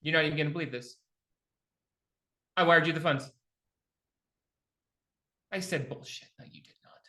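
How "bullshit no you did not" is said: mock whispered disbelief. Retold disbelief at lender's claim.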